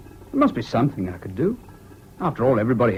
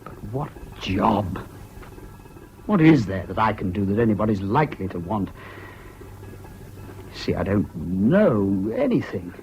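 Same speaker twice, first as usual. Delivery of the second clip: despondent; 6 semitones lower, 4 dB quieter than usual. Acted. Despairs of ever finding work.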